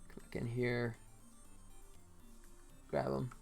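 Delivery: ordinary talk, nothing marked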